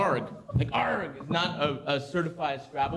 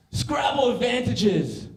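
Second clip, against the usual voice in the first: ordinary talk, nothing marked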